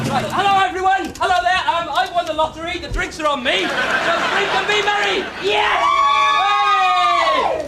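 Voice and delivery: high, loud boisterous shouting; loud pub shouting. Acted. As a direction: shout over the crowd. Buying drinks for the pub.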